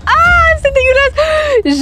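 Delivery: ah traînant et aigu, plein de dégoût, en criant. Retold or imitated. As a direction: crie ton dégoût en recrachant, voix qui monte très haut. Recrache du porc, dégoûtée. (enfant qui recrache le porc à la cantine, en criant de dégoût)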